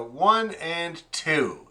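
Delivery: slow, deliberate counting; teacher counting the beat slowly; slow demo count. Shown as a demonstration. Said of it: "there's the big accent".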